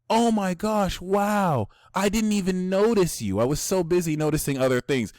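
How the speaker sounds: sugary high faux-nice backhanded compliment; fake sweet compliment. Libra sign dissing someone through a compliment, fake-sweet. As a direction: gush sweetly while slipping in the dig. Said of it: "you're gonna be like".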